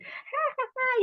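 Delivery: high, put-on party laugh; a hammed-up jolly cackle; forced cheerful laugh. Playing the fake-happy agrimony type.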